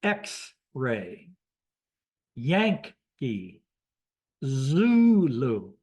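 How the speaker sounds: X-ray, Yankee, Zulu split into syllables; deliberate radio drill, stretched first syllable in Zulu; phonetic alphabet demo. Shown as a demonstration. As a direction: sound out ECKS-ray, YANK-ee, ZOO-loo. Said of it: "shows you how you pronounce it".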